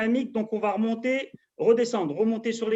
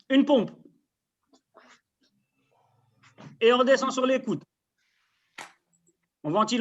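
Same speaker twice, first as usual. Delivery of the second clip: loud coach calls; 4 dB louder than usual. Real, not acted. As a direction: bark the reps out to the class. Coach calls reps, class straining.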